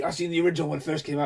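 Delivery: lightly voiced grandma boasting; mock grandma. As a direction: rattle it off like a know-it-all gran. Grandma quoted in a story.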